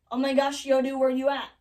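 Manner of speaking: loud slangy shout; hammed-up loud translation; mock calling out. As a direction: call out loudly in mock modern dismay.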